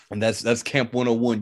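usual speaking voice

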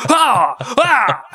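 high, crying gasp of terror; hammed-up scared whimper; mock fright cry. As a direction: jolt awake terrified. Acting out a scared wakeup.

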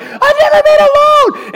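very high, loud comic shriek; mock panic scream. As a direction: blurt it out in exaggerated panic. Retold honeymoon panic, acted.

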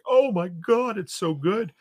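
high, overcome mock wail of praise; retold emotional gushing. Driver moved by an audiobook, retold high-pitched gushing. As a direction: gush it high, acting out being moved. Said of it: "Billy Summers made me cry".

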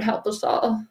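ordinary delivery

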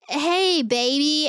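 high, sing-song come-on; lightly voiced leering guy hitting on her; playful imitation of a catcaller; mock catcall. Men catcalling a woman.

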